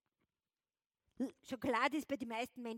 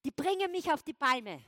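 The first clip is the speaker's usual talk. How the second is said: mock angry outburst; 2 semitones higher, 10 dB louder than usual. Acting out being angry.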